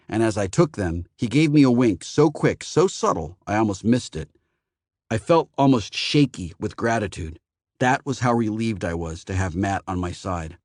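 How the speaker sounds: plain reading voice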